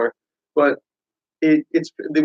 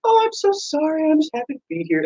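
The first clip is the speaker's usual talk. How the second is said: meek fake humble; 12 semitones higher, 4 dB quieter than usual. Wrestler mocking a humble rival, high and meek. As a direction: play the shy newcomer, apologizing sweetly. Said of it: "It made her kinda look kinda like".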